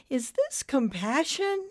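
high, questioning lilt; wondering question. A narrator's reading. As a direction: ask it in pained wonder. Cloud's pained wondering question.

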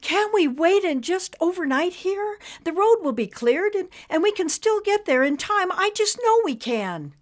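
put-on older wife pleading in the parking lot. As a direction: plead with your husband to stay. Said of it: "pleading with her husband".

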